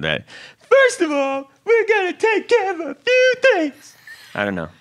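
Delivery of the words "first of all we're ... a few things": much higher rock frontman stage call, hammed up. Podcast host redoing his intro as a rock-star frontman, much higher and hammed up. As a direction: announce it like a rock star greeting the arena. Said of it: "I sound like [name]".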